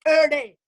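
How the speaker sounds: loud mock "er day", slurred for every day; daughter's slang. Daughter griping about mom, "er day" imitated as loud slang. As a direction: punch out "er day" as youth slang. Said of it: "her language".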